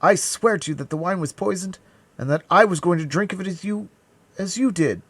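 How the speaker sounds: high, loud pleading; desperate vow. A narrator's reading. D'Artagnan swearing about poisoned wine, high desperate vow. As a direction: plead innocence with all urgency. Swearing innocence to dying man.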